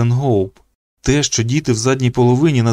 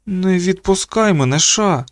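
higher pitch, a touch of alarm; mild panic; 7 semitones higher than usual. A narrator's reading. Boy begging his friend not to let go, mildly panicky. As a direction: plead with contained fear. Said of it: "схожим на паніку".

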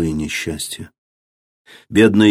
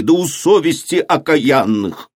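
shouting; 6 semitones higher than usual. A narrator's reading. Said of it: "закричали ему из толпы".

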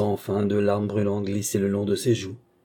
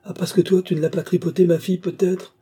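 higher, louder, accusing snap; angry retort; 9 semitones higher, 5 dB louder than usual. Acted. Mother to the young man, furious accusation. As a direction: throw the accusation back in his face.

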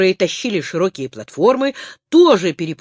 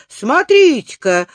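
радостный возглас; восхищённо, громко; восторженно; 5 semitones higher, 6 dB louder than usual. A narrator's reading. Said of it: "с восторгом сказал он". (восторженный крик)

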